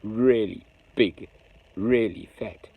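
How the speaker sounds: usual speaking voice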